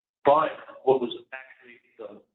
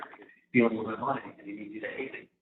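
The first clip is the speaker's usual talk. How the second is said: normal talking voice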